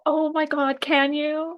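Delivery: high, thrilled plea; excited. Real, not acted. Host begging guest to send the reunion video, excited. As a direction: ask eagerly, pitched well up. Eager to get promised video.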